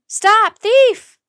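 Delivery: high loud yell. A narrator's reading. Two boys yelling after Jack in a chase. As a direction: shout down the street. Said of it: "both boys were yelling now".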